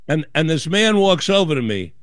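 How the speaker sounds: ordinary delivery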